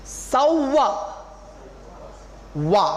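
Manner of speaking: सौवा with a clear व, never ब; clear VA sound. Shown as a demonstration. Teacher drilling the word, clear v sound in सौवा. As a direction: punch out वा on its own after सौवा. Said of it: "वा का उच्चारण होना चाहिए".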